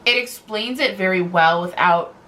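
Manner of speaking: normal talking voice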